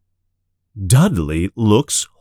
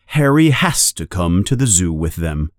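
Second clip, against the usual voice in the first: usual speaking voice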